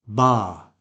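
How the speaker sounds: Ba said as BAH; long back vowel. Shown as a demonstration. Teacher isolating the first syllable, long back vowel. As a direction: say Ba with the ah of arm. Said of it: "some people say basketball".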